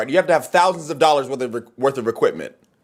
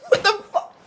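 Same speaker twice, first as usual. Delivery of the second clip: high squeak of laughing disbelief; high laughing what the fuck. Real, not acted. Laughing at the comment.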